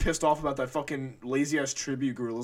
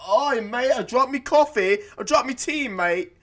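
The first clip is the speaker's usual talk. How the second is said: mock British accent; 9 semitones higher, 6 dB louder than usual. Mocking British people to friends, loud Cockney. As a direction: ham up a Cockney mate. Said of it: "They're fucking British people, like".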